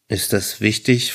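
ordinary delivery

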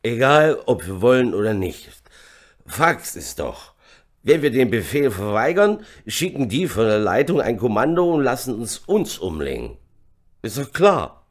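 loud, forceful growling; growled argument. A narrator's reading. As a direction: drive the point home angrily. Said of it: "grollte Vitius". (lautes, grollendes Einreden)

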